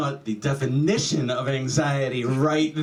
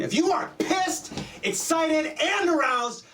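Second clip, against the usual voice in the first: very high, heightened excited list; worked-up excitement. Shown as a demonstration. Comic lists reasons for three exclamation points, worked up. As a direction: pitch each emotion up high. Acting out three heated emotions.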